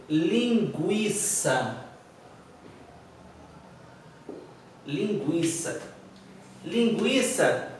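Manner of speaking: "linguiça" slowly, the U sounded as a w after the g. Shown as a demonstration. Teacher giving an example word, "linguiça" slow with U sounded. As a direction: stretch "linguiça" out, letting the U be heard. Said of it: "o som do U e do A".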